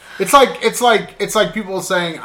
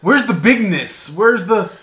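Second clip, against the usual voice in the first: louder announcement; 5 semitones higher, 5 dB louder than usual. Shown as a demonstration. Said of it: "That was much better".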